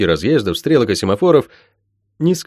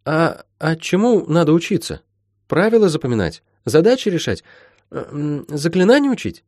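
put-on higher, hesitant girl's questions; inquiring Molly; 8 semitones higher than usual. Molly asking healer what to study, hesitant and curious. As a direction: puzzle it out in a light girlish voice.

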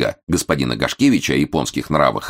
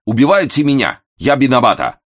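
high, loud wail in broken Russian; panicked cry, Japanese accent; 9 semitones higher, 6 dB louder than usual. A narrator's reading. The Japanese valet wails to the angry guest, high and loud. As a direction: cry out louder the second time, panicking.

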